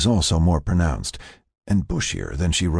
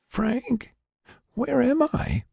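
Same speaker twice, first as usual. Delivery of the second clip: very high, frail waking voice; weak, confused patient; slower than usual. Acted. Patient waking from stasis, high weak confusion. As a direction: ask feebly, pitched way up.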